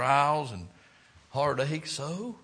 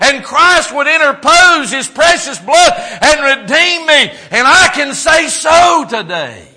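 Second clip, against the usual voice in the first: loud, higher preaching about being saved; full-force preaching; 14 semitones higher, 18 dB louder than usual. Acted. Pastor testifying redemption to the church, full force preaching. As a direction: pour it out from the pulpit.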